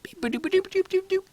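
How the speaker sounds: high droid beeps and boops; robot beeping. Acted. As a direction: chirp it like a little robot. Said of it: "makes beeping sounds".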